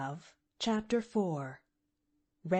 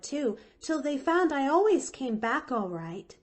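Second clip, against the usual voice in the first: put-on high, louder child's voice, bubbling reassurance; eager little girl Pollyanna, apologetic; 7 semitones higher, 4 dB louder than usual. Pollyanna apologizes to frightened Nancy, high and eager.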